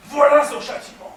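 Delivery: a furious shout at the top of the voice. Acted. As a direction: roar it out in rage. Avenging husband strikes rival.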